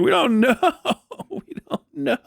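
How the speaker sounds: laughing through it, pitch up high; can't keep a straight face while saying it. Real, not acted. Marine mocking the Army's base security, laughing.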